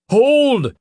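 loud high command; commanding shout. A narrator's reading. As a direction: bark the order to stop. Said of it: "the captain called".

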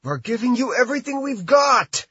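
strained high voice through clenched teeth. A narrator's reading. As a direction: force it out through gritted teeth. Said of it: "Kim said through clenched teeth".

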